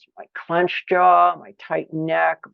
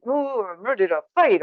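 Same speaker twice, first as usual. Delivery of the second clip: mock ready to fight; 3 semitones higher than usual. Shown as a demonstration. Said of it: "The fight, flight".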